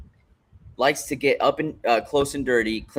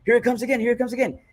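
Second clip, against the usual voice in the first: high-pitched imitation of a cornerman; mock excited warning call, pitched way up; excited coach; 10 semitones higher, faster than usual. Co-host mimicking the coach's calls, high and excited. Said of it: "And then he goes".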